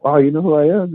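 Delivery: retold wonder, pitched high; mock amazement. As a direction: act pleasantly stunned, voice lifted high. Retells amazed reply to young fans.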